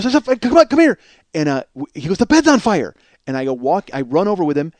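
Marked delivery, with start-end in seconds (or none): 0.0-1.0 high, loud, frantic summons; acted urgency. Brother urges him to come. 2.2-2.9 put-on high, loud kid's cry; urgent pitched-up shout; acted alarm. Brother reports the burning bed.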